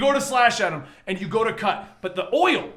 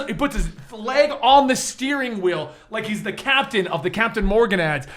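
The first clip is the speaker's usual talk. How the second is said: ordinary delivery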